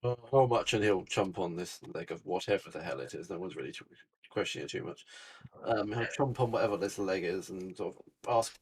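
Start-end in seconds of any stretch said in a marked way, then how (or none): none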